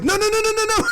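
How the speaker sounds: imitated panicked "stop him", loud and very high; frantic protest. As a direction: fire it out in a high, urgent mock panic. Kurt frantically stopping the fight.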